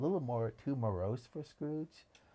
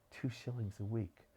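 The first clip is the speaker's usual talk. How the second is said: ordinary delivery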